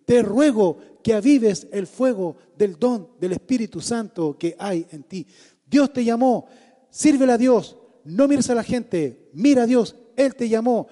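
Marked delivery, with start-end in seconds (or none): 5.7-10.9 louder, urgent preaching exhortation; fervent exhortation. Acted. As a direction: command him to serve God. (exhortación predicadora con fuerza)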